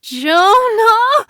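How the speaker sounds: high loud scream of terror; a woman screaming a dying friend's name; terrified scream. Acted. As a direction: scream his name in horror. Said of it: "Jo Beth screamed in terror".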